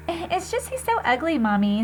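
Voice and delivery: put-on a small boy telling mommy about nightmares; little boy voice. Little brother telling mom, high kid voice.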